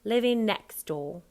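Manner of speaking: "next door" run together, t sliding into d; loud NEXT DOOR with the t barely there. Shown as a demonstration. Coach showing the actor, sliding T into D. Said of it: "slides into the D of door".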